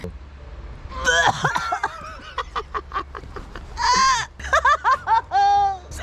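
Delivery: choking, retching laugh; gagging laugh. Real, not acted. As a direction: laugh and gag at the awful taste. Tasting cod liver oil.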